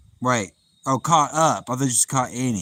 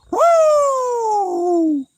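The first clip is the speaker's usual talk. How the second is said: very high, loud whoop of joy; live-stream whoop at a fan; excited whoop; 8 dB louder than usual. Real, not acted. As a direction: let out a big whoop.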